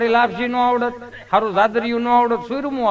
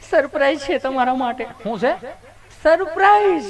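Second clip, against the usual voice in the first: teasing surprise; 7 semitones higher than usual. Retold or imitated.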